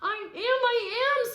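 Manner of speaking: high, loud, slow cry; shouting. A narrator's reading. Sylvester crying out to his parents, loud shout. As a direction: call out I am. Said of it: "Sylvester wanted to shout".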